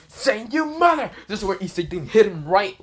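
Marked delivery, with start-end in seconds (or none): 0.5-1.1 high, loud pained shriek; scream of agony. Acted. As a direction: shriek through the pain. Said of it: "Frieza screams in agony".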